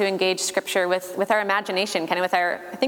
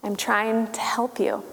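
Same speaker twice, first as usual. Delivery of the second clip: unvoiced gentle whisper. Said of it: "God is patient with that and says".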